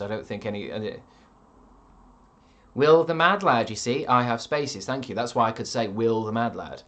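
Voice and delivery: ordinary talk, nothing marked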